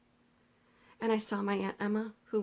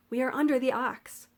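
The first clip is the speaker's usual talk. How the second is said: raised, slightly higher call; yelled over water; 4 semitones higher, 4 dB louder than usual. A narrator's reading. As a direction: keep calling out over the current. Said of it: "I yelled over the water".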